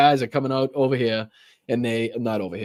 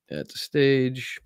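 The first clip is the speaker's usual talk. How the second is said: ordinary delivery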